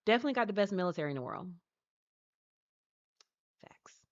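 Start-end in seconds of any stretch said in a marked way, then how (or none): 3.6-4.0 a breathy whispered aside, agreeing; whispered facts. Pretend. Streamer agreeing with chat about the military, in a whisper. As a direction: lean in and whisper it like a secret.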